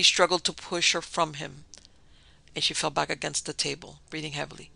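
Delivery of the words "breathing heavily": plain reading voice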